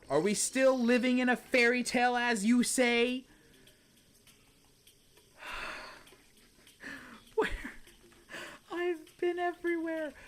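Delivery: high incredulous sigh of where; high exasperated plea; exasperated disbelief. Real, not acted. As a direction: throw up your hands, searched everywhere. Exasperated searching.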